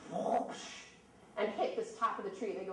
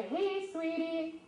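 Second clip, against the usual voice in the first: slow, higher whistled chickadee call; chickadee song imitation; 7 semitones higher, much slower than usual. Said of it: "he's whistling".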